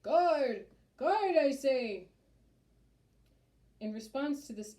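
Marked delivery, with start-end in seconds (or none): 0.0-2.1 very loud, high summons; ear-splitting mayor's call; top-of-lungs bellow. A narrator's reading. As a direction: bellow for the guard, drawing it out. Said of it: "bellowed at the top of his lungs".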